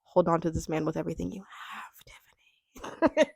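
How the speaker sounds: whispered plea to herself; urgent inner whisper. Retold or imitated. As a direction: hiss it under your breath like a secret warning. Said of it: "a little bit of me was like".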